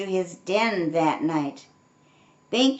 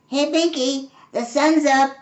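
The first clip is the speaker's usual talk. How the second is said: higher, louder call into the den; 6 semitones higher, 5 dB louder than usual. A narrator's reading. Fox calling down into the den to wake Binky. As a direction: call down to a sleeping friend. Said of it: "called his friend Artie the fox".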